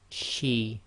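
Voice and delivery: light shin, clear sh; Arabic shin with kasra. Shown as a demonstration. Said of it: "letter demo".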